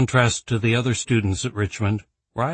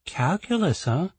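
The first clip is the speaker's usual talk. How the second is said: teasing; 8 semitones higher than usual. Acted. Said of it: "with a teasing note in her voice".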